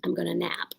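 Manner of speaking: soft whispered aside. Real, not acted. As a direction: whisper it like a secret.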